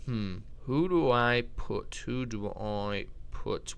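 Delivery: slow, musing to oneself; thinking aloud. Real, not acted. Musing over the next pick.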